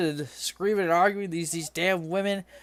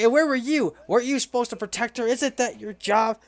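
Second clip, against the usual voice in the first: a hint of an angry grieving man, higher; accusing yell; 6 semitones higher than usual. Grieving man rounding on the Minotaur, mildly mock angry and higher. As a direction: snap at the bodyguard in mild mock anger. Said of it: "He starts yelling at the Minotaur".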